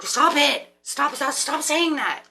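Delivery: a mock inner voice telling her off; intuition scolding. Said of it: "your intuition's like".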